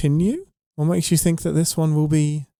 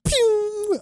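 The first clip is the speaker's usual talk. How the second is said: pew sound effect. Pretend. Playful sound effect after a joke.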